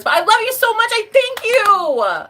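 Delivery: gushing thanks. Real, not acted. Streamer gushing thanks to Stevie for the gift.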